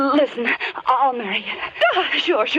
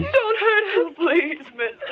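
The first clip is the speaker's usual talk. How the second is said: frightened plea; 5 semitones higher than usual. Acted. Girl pleads with captor for sister.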